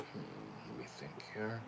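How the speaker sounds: whispered to himself; whispering. Real, not acted. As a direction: mutter it under your breath. Thinking aloud to himself.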